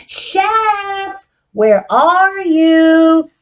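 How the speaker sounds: slow sing-song call. Acted. Teacher calls the hiding puppet in a sing-song voice. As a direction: call Chef slowly.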